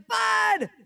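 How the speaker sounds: high, loud holler; calling out. Retold or imitated. Calling to best friend from the car at night, excited holler. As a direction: yell up to a friend's house.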